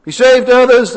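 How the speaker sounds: loud, high mocking jeer. Chief priests jeering at Jesus on the cross, loud mockery. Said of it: "they mocked him as well".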